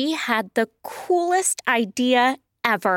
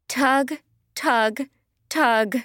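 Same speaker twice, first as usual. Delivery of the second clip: usual speaking voice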